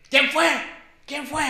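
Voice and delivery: shouted who did it; angry demand. Retold or imitated. Chore standing up, demanding who threw it, shouting. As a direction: bark the question at the room. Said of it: "se para el Chore, güey, y dice".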